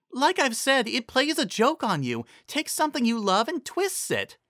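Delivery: a patient pony explaining magic; lightly voiced pony mare. A narrator's reading. Carrot Top explains poison joke to friends, lightly voiced mare.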